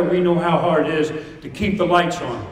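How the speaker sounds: ordinary talk, nothing marked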